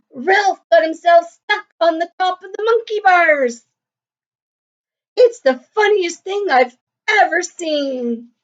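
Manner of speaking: high, excited yell. Acted. Tony yelling gleefully about Ralph on the monkey bars. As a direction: call it across the playground, delighted. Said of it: "Tony yelled".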